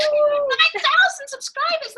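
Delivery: slow, high and loud in awe; amazed. Real, not acted. Host repeating the guest's subscriber count, slow amazed cry. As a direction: draw it out, thrilled.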